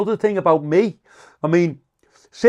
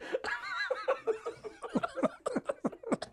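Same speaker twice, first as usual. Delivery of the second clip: wheezing laugh; 14 dB quieter than usual. Real, not acted. High quiet laugh.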